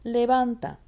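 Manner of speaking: loud, high "Levanta" with weight on "van"; stress on VAN. Shown as a demonstration. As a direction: punch the middle syllable of "Levanta". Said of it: "It's on the van".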